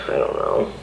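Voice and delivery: low, breathless, breaking whisper; I don't know almost voiceless, choking up; tearful whisper. Real, not acted. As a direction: barely get it out through tears. Tearful, overwhelmed mother venting.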